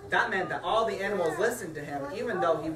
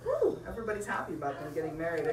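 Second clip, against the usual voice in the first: ordinary delivery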